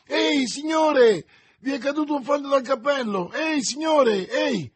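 very high, loud shouts; calling out. A narrator's reading. Stableman after a rider who dropped a paper, shouting. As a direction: keep hailing someone getting away, urgently. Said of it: "esclamò uno stalliere rincorrendo lo sconosciuto".

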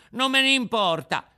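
high, loud stubborn retort; defiant puppet child. Acted. Puppet to the warning Fairy, defiant. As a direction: throw it back high and loud.

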